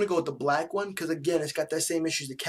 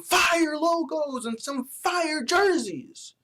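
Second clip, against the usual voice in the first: excited shout; 12 semitones higher, 4 dB louder, much slower than usual. Real, not acted. Urging teams for better jerseys.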